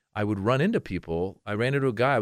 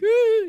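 squeaky high loud frightened whimper; 9 dB louder than usual. Retold or imitated. Kid whimpering away from the yelling dad, very high. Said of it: "you just whimper away".

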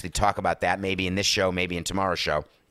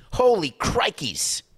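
exasperated; 10 semitones higher, 3 dB louder, slower than usual. Real, not acted. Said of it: "host reacting to the apology".

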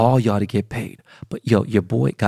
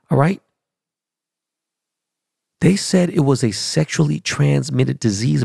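normal talking voice

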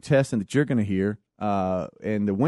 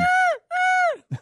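screechy seagull voice. Pretend. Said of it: "Does it sound like that?".

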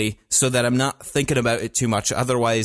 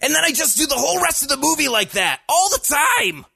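yelly whiny Luke Skywalker. Retold or imitated. Describing his whole movie in Luke's whiny yell. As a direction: complain it up high like a whiny teen. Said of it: "him being yelly and whiny".

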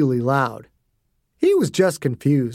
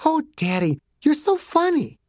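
put-on high, light child voice; a little girl amused, sing-song; 12 semitones higher than usual. A narrator's reading. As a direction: daughter teasing her pleading father, high and light. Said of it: "Chelsea laughed".

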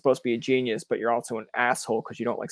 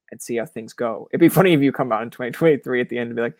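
ordinary talk

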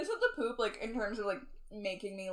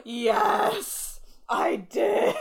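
emphatic yes; 6 dB louder, much slower than usual. Retold or imitated. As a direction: gush it out like a fan. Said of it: "And I was like".

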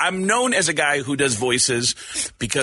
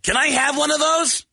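high voice; 10 semitones higher than usual. Retold or imitated. Asking Gene.

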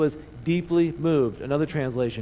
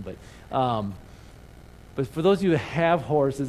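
ordinary delivery

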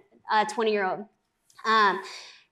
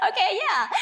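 high laughing agreement; 9 semitones higher than usual. Retold or imitated. Recalling agreeing to meet the guy, laughing. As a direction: laugh through an awkward yes.

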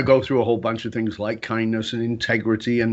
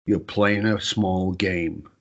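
very calm; 4 semitones lower than usual. Retold or imitated. Wife telling husband he plays small, very calm. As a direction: deliver the truth evenly, pausing between phrases. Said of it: "Wife very calmly".

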